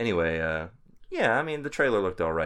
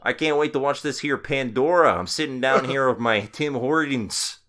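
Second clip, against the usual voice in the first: put-on Canadian accent; 5 semitones higher, 4 dB louder than usual. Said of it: "I could do a good Canadian accent".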